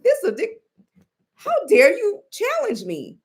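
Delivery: high-pitched mock outrage; Donald Trump. Imitating the president outraged at being challenged, mad. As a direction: squeal it like an affronted ruler, hammed-up. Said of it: "he's mad".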